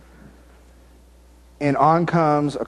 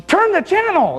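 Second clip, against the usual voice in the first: high-pitched mock shout of a wife; husband mimicking his wife's sharp high command; mock wife yelling; 3 dB louder than usual. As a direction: squeal it like an annoyed wife. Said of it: "Your wife's yelling at you".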